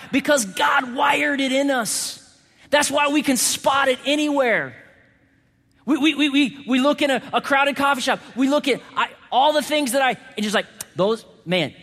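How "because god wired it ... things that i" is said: high, excited preacher's cry; full-force preaching. Real, not acted. Preacher insists God wired love in us, impassioned preaching. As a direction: pitch it right up and press on urgently.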